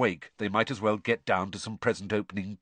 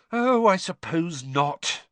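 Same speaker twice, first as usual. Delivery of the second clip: drawn-out, higher-pitched resigned sigh; 7 semitones higher, 3 dB louder, slower than usual. A narrator's reading. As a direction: give in reluctantly, slowly. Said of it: "she sighed".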